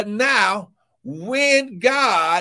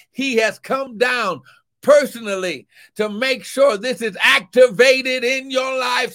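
rising preacher's call, shouting the key words; preaching; 6 semitones higher than usual. Acted. Preacher to congregation, rousing shouted call to praise. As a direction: urge the church toward praise.

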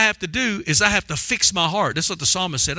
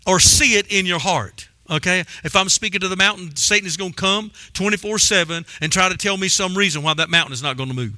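ordinary talk, nothing marked

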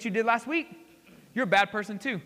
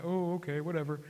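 bored shrug; 5 semitones lower, 6 dB quieter than usual. Retold or imitated. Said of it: "we're just kinda like".

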